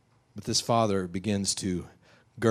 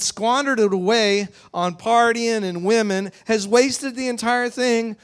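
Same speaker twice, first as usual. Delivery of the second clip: high, loud indignant rant, stretched phrases; furious; 11 semitones higher, 8 dB louder than usual. Acted. Older son accusing his father over the party, furious. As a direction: hammer out the accusation. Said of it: "And now he's furious".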